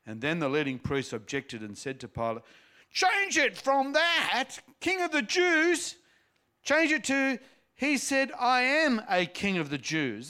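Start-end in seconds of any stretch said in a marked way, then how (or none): 2.9-10.3 high, loud, angry demand; cross objection. A narrator's reading. Priests objecting to Pilate about the sign, angry and loud. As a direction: insist hard on the change. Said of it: "They're cross with the sign, really cross".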